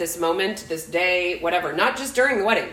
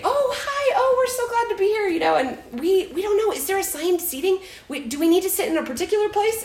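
much higher, bright guests' voice; sweet newcomers; 11 semitones higher, faster than usual. Retold or imitated. Imitating church newcomers greeting her, much higher sweet voice. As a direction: put on the eager guests, high. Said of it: "But then they say".

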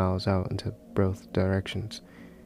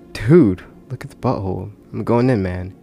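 louder, eager call of a buddy; excited exclaiming; 8 dB louder than usual. Acted. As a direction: shout it out with drunk excitement. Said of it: "he exclaimed".

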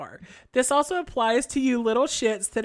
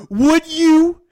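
loud challenge; 4 semitones higher, 11 dB louder than usual. Retold or imitated. Reading angry email aloud.

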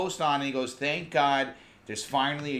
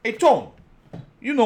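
put-on Guido accent; 9 semitones higher, 9 dB louder than usual. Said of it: "I could do a Guido accent".

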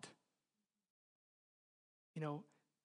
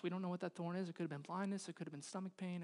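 ordinary talk

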